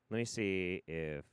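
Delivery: usual speaking voice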